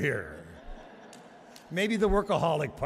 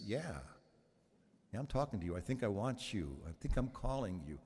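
voice of God; 4 semitones lower, 6 dB quieter than usual. Retold or imitated. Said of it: "the answer is".

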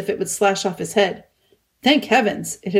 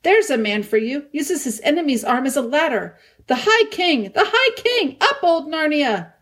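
much higher, cheering chant of the king; delighted cheering; 7 semitones higher than usual. A narrator's reading. Trumpkin cheers the High King, much higher delighted chant. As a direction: whoop it up with delight. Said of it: "dancing with delight".

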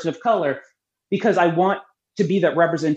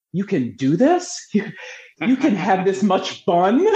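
slow, wide-eyed disbelief, laughing after; retelling an incredulous reaction; retold amazement; 2 semitones higher than usual. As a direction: stretch the questions out in mock astonishment.

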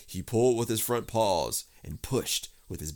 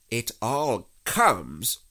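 slow, loud, measured rebuke; stern; 4 semitones higher, 7 dB louder, much slower than usual. A narrator's reading. Rabbit scolds stuck Pooh, stern. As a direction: lay down the verdict slowly and firmly. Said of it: "said Rabbit sternly".

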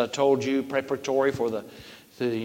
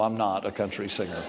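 "I'm not a country singer" said softly and low, taking the joke back; quiet denial; 5 semitones lower, 7 dB quieter than usual. Real, not acted. Preacher taking back his country-singer joke, softly and low. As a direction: drop the voice to take back the joke. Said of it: "but no".

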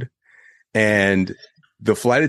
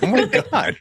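shocked. Retold or imitated. Retold shock at escaping barricade.

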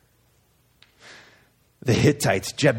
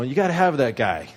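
usual speaking voice

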